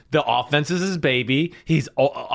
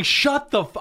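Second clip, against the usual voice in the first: high, sharp mock yell in the huddle; shut-up yell at the coach, played for laughs; 11 semitones higher than usual. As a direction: snap it off mid-curse, higher. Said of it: "I'm going".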